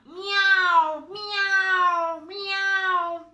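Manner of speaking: high loud nasal "Miau"; cat meow. Shown as a demonstration. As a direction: meow through the mask. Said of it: "El gato". (maullido nasal y agudo)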